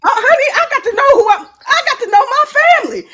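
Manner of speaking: high, loud and fast gushing; excited. Real, not acted. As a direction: gush back at the guest. Excited reply to guest.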